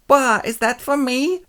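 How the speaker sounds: high, slow gushing voice; delighted. Acted. Escort friend is shown a handkerchief, mock delight. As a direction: gush over an unexpected present.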